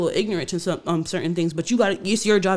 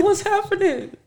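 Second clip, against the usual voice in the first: startled question; 14 semitones higher, slower than usual. Retold or imitated. Retold shock walking into room.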